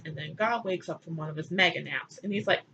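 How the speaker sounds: ordinary talk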